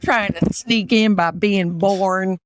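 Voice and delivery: slow mock Southern drawl; Southern accent. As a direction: draw it out like a suspicious Southerner. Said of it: "can you do an Appalachian accent".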